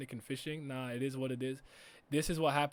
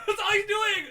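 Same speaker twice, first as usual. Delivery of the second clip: high, loud cry through laughter; laughing outburst; 9 dB louder than usual. Real, not acted. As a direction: squeal it while cracking up. Laughing at the boring fight.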